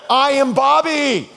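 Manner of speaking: high, loud, laughing big reveal; triumphant reveal. Retold or imitated. Pastor unmasking himself to his colleague, laughing loud. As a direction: announce yourself with a burst of laughter.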